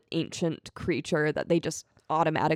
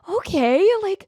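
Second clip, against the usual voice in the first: high and loud, instantly sold; eager yes; 13 semitones higher, 6 dB louder, slower than usual. Retold or imitated. Host imagining accepting Tom's invitation, eager and loud. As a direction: jump at the offer like it's the best idea ever. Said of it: "I would be like".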